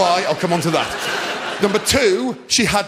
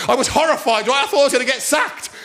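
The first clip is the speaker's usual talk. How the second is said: ordinary delivery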